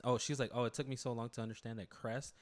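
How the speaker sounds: ordinary delivery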